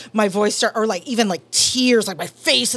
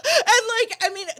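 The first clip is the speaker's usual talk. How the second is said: laughing; 12 semitones higher than usual. Real, not acted. Laughing after the story.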